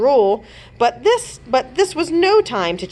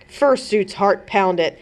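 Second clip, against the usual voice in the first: plain reading voice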